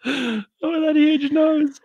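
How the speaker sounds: high, slow laughing squeal. Real, not acted. Still laughing about the Nan sketch, high and slow.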